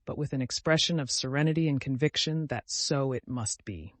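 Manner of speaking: plain reading voice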